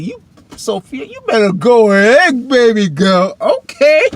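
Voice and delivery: loud, high, sassy approval; hyped cheering on. Acted. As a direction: egg her on, voice jumping way up. Cheers Sophia on.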